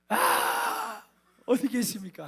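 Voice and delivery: very high loud cry, then pleading; loud high wail to God; anguished cry. Retold or imitated. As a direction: shout up to heaven in despair. Crying out to God again.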